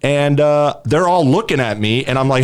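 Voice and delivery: ordinary talk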